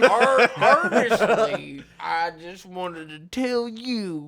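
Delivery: put-on slow, drawn-out drunken Southern slur; hammed-up drunk, stretching the words. Host playing a drunk calling Honesty by name, slurring slowly. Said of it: "how I say your name when I'm drunk".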